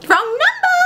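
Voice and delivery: very high, singsong dismissal; sassy assistant. Acted. Assistant dismissing the caller, high sassy singsong. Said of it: "he's a little sassy".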